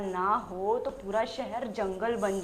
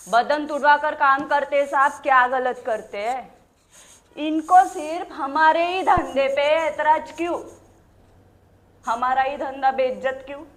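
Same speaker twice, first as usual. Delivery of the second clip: loud, raised-voice protest to a crowd; indignant challenge; 3 semitones higher, 7 dB louder than usual. Acted. Defending her trade before society's guardians, loud and indignant. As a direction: throw the questions out loudly, demanding answers.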